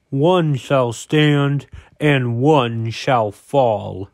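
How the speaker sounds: slow, grave epic pronouncement; dramatic movie hero voice. Pretend. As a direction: intone it slowly like a movie trailer. Quoting a dramatic movie line.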